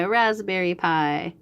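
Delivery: ordinary delivery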